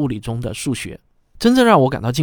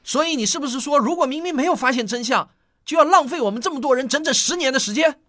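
much higher, pressing accusing question; outraged at the wasted years; indignant protest; 13 semitones higher than usual. Acted. Father confronting the chief scientist, high indignant protest.

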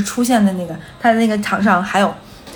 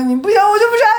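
very high, playful refusal; mock refusal; 12 semitones higher, 3 dB louder, faster than usual. Hostess turning down the pushy customer, mock stubborn refusal. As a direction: act out a stubborn no, pitched way up. Retelling a bickering standoff.

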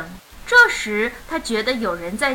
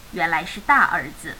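usual speaking voice

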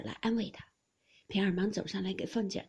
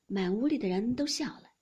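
ordinary delivery, nothing marked

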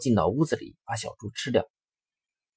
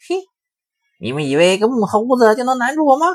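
much higher, louder mocking wolf voice; taunting wolf; 13 semitones higher, 7 dB louder, faster than usual. Wolf at the wooden door taunting the two pigs, high and loud. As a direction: taunt them through the door. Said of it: "大灰狼追到门前停下来".

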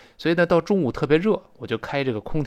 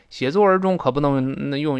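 normal talking voice

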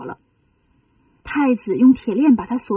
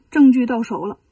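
ordinary delivery, nothing marked

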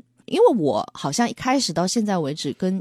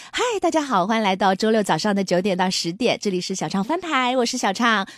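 radio host intro; 2 semitones higher than usual. Shown as a demonstration. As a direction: greet listeners like a polished DJ. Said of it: "典型的电台范儿了".

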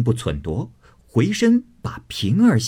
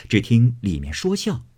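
ordinary delivery, nothing marked

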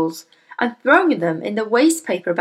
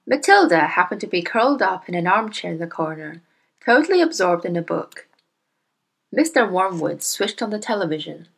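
ordinary delivery, nothing marked

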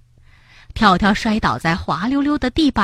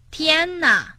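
crying out; 8 semitones higher, 3 dB quieter than usual. A narrator's reading. Tiaotiao seeing oily footprints, crying out. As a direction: exclaim in high, mild alarm. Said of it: "跳跳大声喊道".

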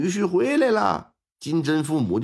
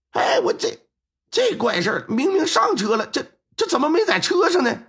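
alarmed disbelief; 10 semitones higher than usual. Acted. Driver finds bride missing.

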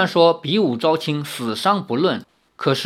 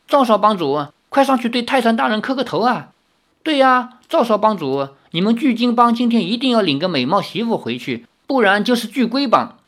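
jeering crowd; 7 semitones higher than usual. Acted. Hecklers jeer.

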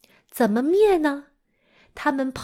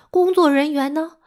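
ordinary delivery

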